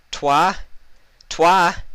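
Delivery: high, loud calling out; searching for Twilight, desperate yell. A narrator's reading. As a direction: shout her friend's name in a panic. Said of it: "Applejack called out desperately".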